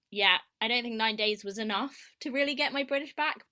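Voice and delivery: ordinary talk, nothing marked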